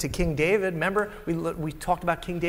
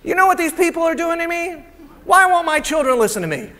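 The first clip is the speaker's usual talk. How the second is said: much higher, louder whining pastor; whiny complaint; 11 semitones higher, 7 dB louder than usual. Acted. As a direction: whine to God like a put-upon pastor.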